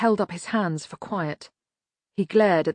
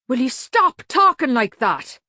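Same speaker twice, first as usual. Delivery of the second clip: loud, high, exasperated outburst; angry shout; 6 semitones higher, 6 dB louder than usual. A narrator's reading. Medium Dave silencing Cat's Eye, exasperated shout. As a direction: shout him down. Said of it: "shouted Medium Dave".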